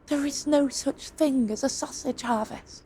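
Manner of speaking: soft, flat dismissal from the lord. Acted. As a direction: cut the nonsense down quietly.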